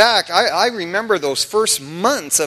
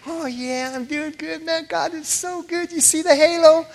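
1 mock pious cheer; 8 semitones higher than usual. Fake pious happy act.

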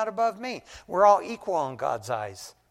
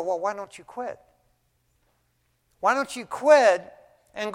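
raised pitch, exasperated questioning; frustrated rebuke, building higher and more insistent; frustrated challenge; 4 semitones higher than usual. Retold or imitated. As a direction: press them with indignant rhetorical questions. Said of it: "man, I was frustrated".

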